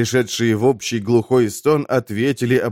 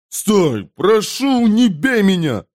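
high, urgent begging; pleading; 10 semitones higher, 3 dB louder, slower than usual. A narrator's reading. Slapped glutton begs his waker, high plea. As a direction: beg for the blows to stop.

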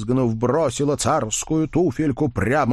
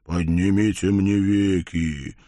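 guttural monster voice; slower than usual. Acted. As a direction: drag it from the belly, slow. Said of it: "утробным голосом произнёс Вий".

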